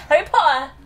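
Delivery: high, loud mock Cockney; Cockney accent. Shown as a demonstration. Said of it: "great about a Cockney accent".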